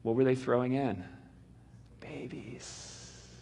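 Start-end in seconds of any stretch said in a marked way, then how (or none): 2.0-3.3 nearly voiceless grim word; grim hushed reveal. Real, not acted. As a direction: drop it to a hush. Revealing infants thrown in Nile.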